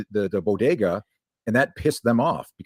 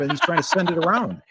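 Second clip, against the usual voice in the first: laughing while talking; words breaking up with laughter; 9 semitones higher than usual. Real, not acted. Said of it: "Look, he's never laughed this much".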